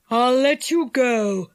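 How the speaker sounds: slow, deliberate villain; enchanter's voice. A narrator's reading. Enchanter lets the captives go, slowly and deliberately. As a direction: concede slowly in character.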